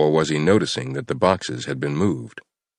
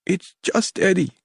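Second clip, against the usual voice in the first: tearful sniffle. Acted. Captive sniffling.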